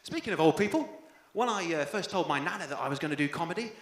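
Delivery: usual speaking voice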